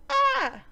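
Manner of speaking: high, loud "ah" yell; short hammed-up scream of outrage; mock anguished scream. Recapper finishes raging over the dragon, mock anguished scream.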